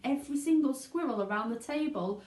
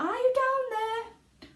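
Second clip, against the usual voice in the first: high, slow calling; shouting down; 4 dB louder, slower than usual. A narrator's reading. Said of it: "shouted Missus Salt".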